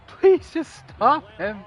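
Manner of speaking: high, loud mock plea; hammed-up desperate pleading; pleading coach. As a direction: beg like a coach at wits' end. Joins in as the coach.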